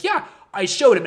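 hammed-up clueless pride; proud Edison. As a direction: chirp it like he did something great. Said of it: "And Edison's like".

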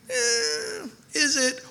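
high-pitched skeptical "eh"; put-on dubious squeak; doubtful hedging. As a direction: draw out a high, hesitant noise of doubt. Voices a doubtful listener's hesitation.

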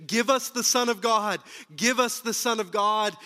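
loud, high mob chant; crowd cheering. Retold or imitated. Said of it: "The crowd cheered".

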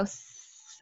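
long soft unvoiced sss, like air leaking; slow hiss. Shown as a demonstration. Imitating the boyfriend's advice on farting silently as a slow hiss. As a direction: let a quiet hiss seep out. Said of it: "because they go".

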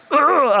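loud, high frustrated ugh; exasperated groan. Retold or imitated. Pastor acting out the little stuff, loud exasperated groan. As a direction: groan out like you've lost your mind. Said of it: "I'm like".

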